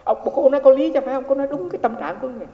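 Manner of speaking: emphatic agreement; emphatic concession. Real, not acted. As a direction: grant her the point with energy. Preacher concedes point emphatically.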